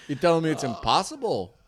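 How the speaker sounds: ordinary talk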